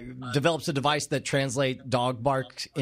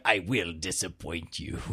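very low, softer villain voice; deep mock-ominous echo of the line; Grand Admiral Thrawn; 10 semitones lower, 4 dB quieter than usual. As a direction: intone it like a menacing commander, pitched far down. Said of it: "Grand Admiral Thrawn".

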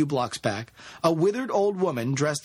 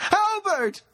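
very high screamed name; anguished high call; desperate scream; 4 dB louder than usual. A narrator's reading. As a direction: scream after him in panic. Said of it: "she screamed".

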